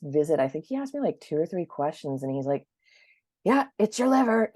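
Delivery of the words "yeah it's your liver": mock doctor voice. Naturopath voiced in retelling.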